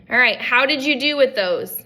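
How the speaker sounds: normal talking voice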